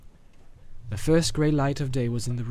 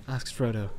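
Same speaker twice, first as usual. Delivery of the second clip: plain reading voice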